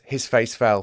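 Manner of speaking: ordinary delivery, nothing marked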